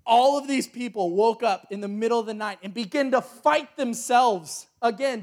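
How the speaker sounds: ordinary talk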